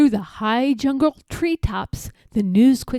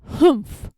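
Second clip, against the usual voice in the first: a scornful nasal-free huff of disdain; short disdainful humph; sour humph; 4 semitones higher, 4 dB louder than usual. A narrator's reading. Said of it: "humphed a voice".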